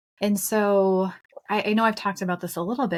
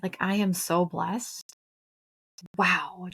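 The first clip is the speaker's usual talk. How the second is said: awed; slower than usual. Retold or imitated. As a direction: hush it like a revelation. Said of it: "it was just this, like".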